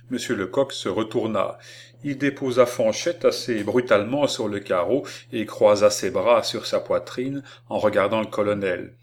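ordinary delivery, nothing marked

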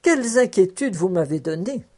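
ordinary delivery

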